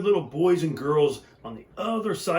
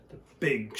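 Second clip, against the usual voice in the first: usual speaking voice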